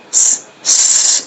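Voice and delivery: long hissed sibilant, sss; teacher demonstrating the isolated s sound; whistling s. Shown as a demonstration. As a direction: hold a thin whistling sss twice. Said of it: "سیٹی کی آواز نکل سکے".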